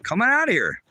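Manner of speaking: high-pitched angry call-out; calling someone out to fight; challenging holler. Real, not acted. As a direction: holler it out as a dare. Daring a threatener to come out.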